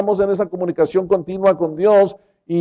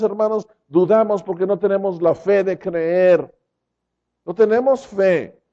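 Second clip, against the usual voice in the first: normal talking voice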